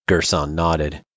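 ordinary delivery, nothing marked

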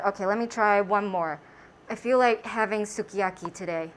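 muffled behind mask; through a face mask. Real, not acted. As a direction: mouth covered by mask.

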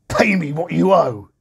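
high, loud demand; shout. A narrator's reading. The first servant shouting at the one he chokes. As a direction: shout it in his face. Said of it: "he shouted".

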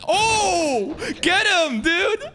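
high, drawn-out laughing yell; excited cheering. Real, not acted. Viewer cheering on a fight, excited high yell. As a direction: whoop and egg the fighter on.

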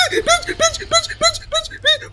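very high frantic chant; high-pitched terrified repetition; panicked squeal. Real, not acted. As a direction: squeal the word over and over in panic. Chased by Slender, panicking.

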